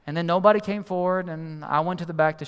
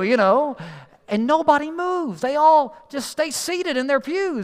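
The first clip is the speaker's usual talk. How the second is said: normal talking voice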